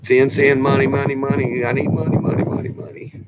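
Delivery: mock her begging for money; imitating his wife nagging him for money. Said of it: "She was".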